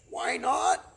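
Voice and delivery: high, loud eager cry; young cygnets crying out, puzzled; chorus of children crying out; cried out. Acted. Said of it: "cried all the cygnets".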